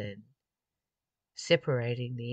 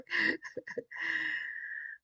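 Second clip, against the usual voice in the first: high laugh. Real, not acted. Amused at own words.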